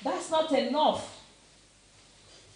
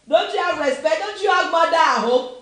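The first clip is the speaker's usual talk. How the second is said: put-on loud, higher scolding of noisy kids; an elder telling off rude youths, shouting; shouted scolding; 5 semitones higher, 10 dB louder than usual. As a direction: yell it like an angry uncle on the bus. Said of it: "we used to shout on these kids".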